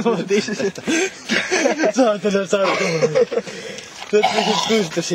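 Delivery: teasing through laughter, pitch up; mock him while laughing; laughing mockery. Real, not acted. Friend laughing at the drunk guy's slurred words.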